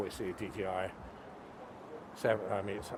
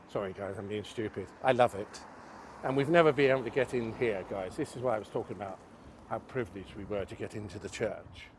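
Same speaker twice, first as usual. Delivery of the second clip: ordinary delivery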